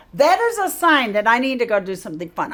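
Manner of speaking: ordinary talk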